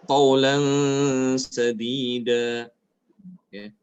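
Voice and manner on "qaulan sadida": slow recitation, nasal held at end of "qaulan"; ikhfa on tanwin. Shown as a demonstration. Teacher gives tanwin example, slow held nasal. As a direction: stretch "qaulan" into a hum before "sadida". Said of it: "Contoh yang tanwin".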